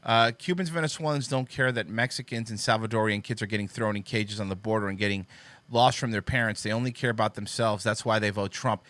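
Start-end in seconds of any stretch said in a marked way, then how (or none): none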